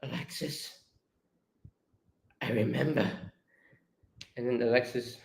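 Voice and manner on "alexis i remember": shaking, very soft realisation; hushed, halting, near tears; voice breaking. Acted. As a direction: barely get the words out, moved. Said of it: "his voice is breaking".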